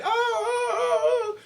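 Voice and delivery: high hammed-up cries; mock moaning. Retelling the woman's cries, high mock moaning. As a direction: wail it up high like a caricature. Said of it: "I'm freaking again".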